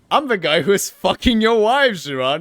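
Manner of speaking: loud, taunting threat; agitated threat. Acted. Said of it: "The voice on the phone is suddenly very agitated".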